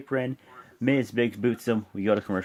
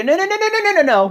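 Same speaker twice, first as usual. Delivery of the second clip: loud, very high run of noes; shouted interruption; 8 dB louder, faster than usual. Retold or imitated. John Cena imitated cutting off his rival, shouting. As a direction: cut in with rapid-fire mock protest.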